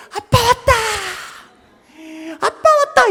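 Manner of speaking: put-on very high, loud little-girl squeal; hammed-up singsong child's cheer; imitating excited daughter. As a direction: squeal like a child thrilled Dad is home. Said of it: "이러면서 이 흥분을 감추지를 못하는 거예요".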